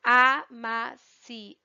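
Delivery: "amaciante" very slow as a, ma, ci, an, te; split into syllables. Shown as a demonstration. Teacher showing pupils each syllable, split apart slowly. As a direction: sound out amaciante in five separate beats. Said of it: "em cada uma dessas sílabas".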